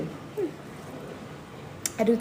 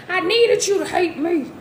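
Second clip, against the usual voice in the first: [name] impression; 9 semitones higher, 3 dB louder than usual. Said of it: "fifty Cent in my head".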